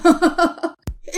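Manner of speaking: high jeering laugh; mocking laughter. A narrator's reading. Classmates all laugh at the boy's taunt, jeering. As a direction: laugh cruelly at the nickname. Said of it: "الكل بقى عمال يضحك على كلامه".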